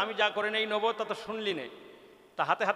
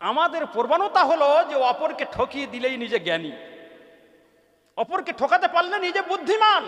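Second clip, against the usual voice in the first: impassioned waz sermon, rising then calming; fiery preaching; 3 dB louder than usual. Acted. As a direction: preach the lesson to the crowd with full force. Preaches the moral.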